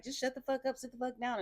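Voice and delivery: high, clipped mock yell; mock impression of the angry girl; imitating her. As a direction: mimic her telling him off. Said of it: "And she was just like".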